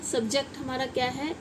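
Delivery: usual speaking voice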